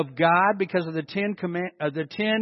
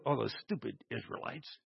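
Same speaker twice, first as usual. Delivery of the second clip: lower, much softer dismissive mock sigh; smug head-shaking; 9 semitones lower, 15 dB quieter than usual. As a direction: play the smug churchgoer judging them under the breath. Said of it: "we shake our heads and we go".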